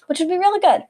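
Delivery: ordinary talk, nothing marked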